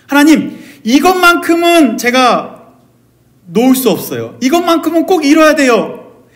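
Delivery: high, urgent appeal to God; desperate pleading. Acted. Believer begging God to keep a dream, desperate plea. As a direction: plead loudly, clinging to it. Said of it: "라고 열심히 발버둥치고 붙잡았던 그것을".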